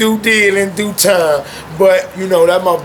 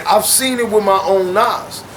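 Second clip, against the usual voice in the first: ordinary delivery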